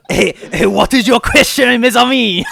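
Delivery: put-on high, loud, slow sing-song French accent; over-the-top mock French accent, half sung. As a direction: ham up a cartoon Frenchman greeting friends. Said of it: "and you can do a French accent?".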